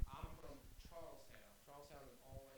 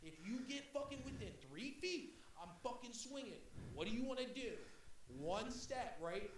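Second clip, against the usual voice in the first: louder, higher, challenging; mock threat; 6 semitones higher, 8 dB louder than usual. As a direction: dare him to step closer. Threatening rival, retold.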